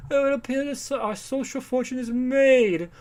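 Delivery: high-pitched, pompous society gentleman; mock upper-class socialite. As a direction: declaim like a caricature of the idle rich. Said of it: "really upper class, like, Manhattan socialites".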